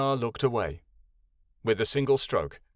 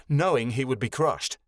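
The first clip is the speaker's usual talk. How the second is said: usual speaking voice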